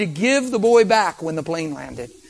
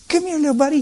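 put-on coaxing a baby; 9 semitones higher, faster than usual.

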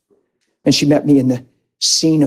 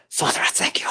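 soft unvoiced whisper of thanks; whispered prayer; 9 dB quieter than usual. Real, not acted. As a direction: breathe the prayer under your breath.

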